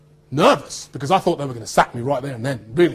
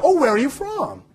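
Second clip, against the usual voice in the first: high, mock-curious question; imitated nosy question; 10 semitones higher than usual. Americans hear a Brit speak, imitated high curiosity. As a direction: pitch it high like an over-interested stranger.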